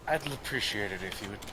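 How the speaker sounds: quiet, controlled request with menace under it; restrained warning. Acted. Kaz looms over man pestering his friend, restrained warning. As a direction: keep it soft and firm.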